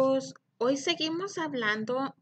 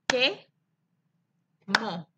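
"Quemó" split as que, long pause, mó; rising first beat, falling second in "Quemó"; clapped syllables. Shown as a demonstration. Teacher counts for pupils, "Quemó" one syllable at a time. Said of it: "A ver cuántas sílabas tiene".

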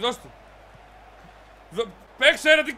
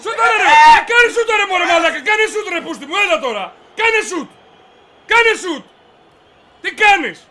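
high, loud orders to a teammate; exasperated shouting; 4 semitones higher, 7 dB louder than usual. Real, not acted. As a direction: yell urgently for the shot.

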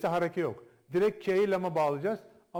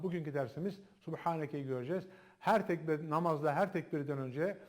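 normal talking voice

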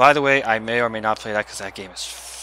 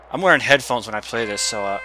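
ordinary talk

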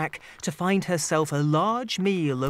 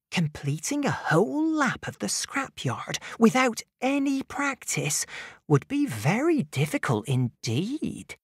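unicorn thinking; 6 semitones higher than usual. Acted. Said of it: "She thought to herself".

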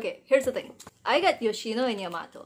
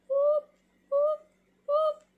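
playful whoops; 4 dB louder, slower than usual. Real, not acted. Lining up photos.

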